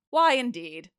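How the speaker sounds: ordinary delivery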